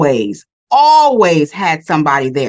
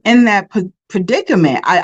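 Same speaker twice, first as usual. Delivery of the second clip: ordinary delivery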